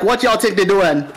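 joking Jamaican patois; Jamaican accent. Retold or imitated. New kid talking to classmates, mock Jamaican accent. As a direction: imitate your younger self's Jamaican accent. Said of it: "they thought I was Jamaican".

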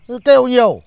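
loud, high irritated snap; snapping. Acted. Exasperated father snaps at his whining son, loud high. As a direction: cut the whining off. Father loses patience with the boy.

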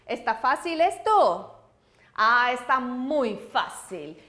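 normal talking voice

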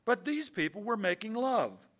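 high, loud, emphatic reading; ringing, insistent defence read aloud; impassioned poem recital. A narrator's reading. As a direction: build to a defiant climax for the audience. Young poet's defiant defence, read out.

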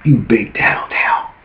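hissed whispered taunt. Pretend. Devil whispering back at the tattler, whispered. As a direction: whisper it under the breath. Aside within acted tattling.

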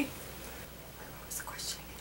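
whispered aside; whispering. Real, not acted. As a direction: whisper it off camera. Aside off camera.

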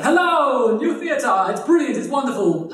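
high, loud mock showman welcome; hammed-up sales pitch. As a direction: sell the theatre like a barker. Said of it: "They're like".

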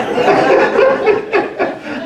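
a high breathy burst of laughter. Real, not acted. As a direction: let the laugh spill out high. Loud laugh after punchline.

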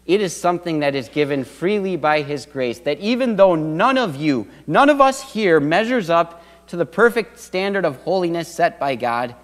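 usual speaking voice

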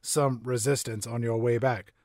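a lightly done deep narrator voice; Morgan Freeman impression. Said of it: "I'm Morgan Freeman".

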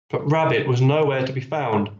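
ordinary delivery, nothing marked

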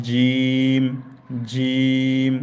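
জিম pressed and stretched long; pressed drawn-out jim. Shown as a demonstration. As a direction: squeeze and drag out জিম. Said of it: "এটা একটু চেপে এবং টেনে".

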